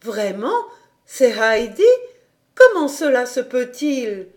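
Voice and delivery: Pierre's mother bursting in surprised; woman exclaiming in disbelief; astonished cry. Acted. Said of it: "elle s'écria".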